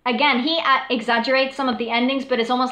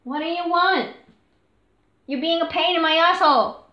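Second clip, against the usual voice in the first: much higher, laughing exasperation at the dog; exasperated high pet voice; 7 semitones higher than usual. Real, not acted. Owner to her whining dog, high and exasperated. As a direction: shoo the pest off, amused.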